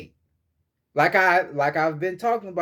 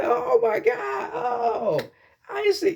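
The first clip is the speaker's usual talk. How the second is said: much higher, slow, overjoyed mock greeting; excited greeting; slower than usual. Showing how to greet a long-missed friend, much higher excited gush. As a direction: gush like spotting an old friend, way up high. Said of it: "You could be like".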